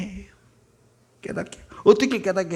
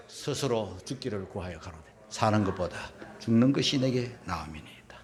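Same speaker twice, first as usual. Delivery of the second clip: hushed reading; 9 semitones lower, 6 dB quieter than usual. Acted. Reading Jonah's death wish.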